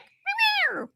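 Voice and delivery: high squeaky cat meowing. Retold or imitated. Voicing the cat to the listeners, high mock meow. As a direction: mew like a haughty cat. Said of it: "the cat's like".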